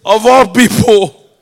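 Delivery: loud, slow mock-outraged cry; exasperated disbelief. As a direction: throw up your hands at your son. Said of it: "I'm like".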